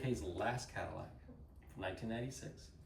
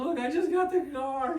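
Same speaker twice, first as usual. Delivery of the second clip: high, loud tearful wail; hammed-up crying protest; mock bawling; 8 dB louder than usual. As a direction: imitate a panicked woman pleading. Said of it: "She is crying".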